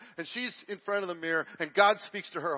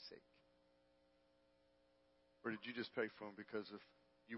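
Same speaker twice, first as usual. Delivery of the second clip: very soft, low, with long pauses; hushed sermon storytelling; 7 semitones lower, 15 dB quieter than usual. Retold or imitated. Preacher retelling God's gentle rebuke to the wife, hushed. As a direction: let it sink almost to nothing, grave and gentle.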